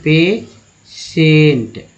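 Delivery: पेशेंट said very slowly as "pay-shent", the ti sounding sh; pronunciation demo. Shown as a demonstration. Teacher modelling the word for students, drawn out. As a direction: draw out पेशेंट with a clear sh sound. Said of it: "इसका उच्चारण ऐसे करते हैं".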